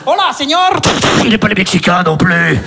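low, gravel-free trailer narrator; low trailer narration for a cop film; movie trailer voice. Pretend. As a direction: announce the lone cop like a trailer. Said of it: "comme si je suis dans un film de flic".

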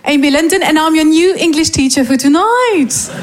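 slow, emphatic classroom introduction in English; English teacher. Shown as a demonstration. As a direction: introduce yourself like a teacher greeting a new class. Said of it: "un petit aperçu du métier".

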